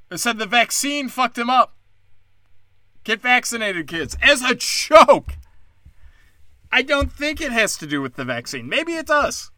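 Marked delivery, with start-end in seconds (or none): 4.2-5.4 higher, louder, slow and deliberate; slow emphatic. Real, not acted. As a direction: spell it out word by word.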